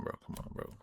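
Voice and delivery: usual speaking voice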